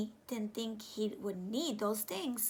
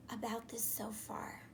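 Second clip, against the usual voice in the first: breathy whispered question; stage whisper to the class; 3 semitones lower, 10 dB quieter than usual. Acted. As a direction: whisper it to the kids.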